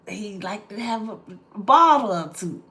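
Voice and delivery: normal talking voice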